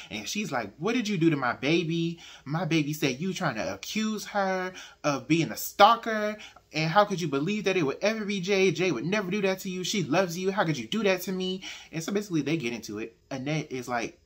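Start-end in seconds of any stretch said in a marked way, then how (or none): 0.8-11.6 a loud, higher accusing outburst, imitating an angry mother; steaming mad. Mother storms over, accusing her friend, loud and furious. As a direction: demand answers, hammed-up fury. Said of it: "Rhoda comes over steaming mad".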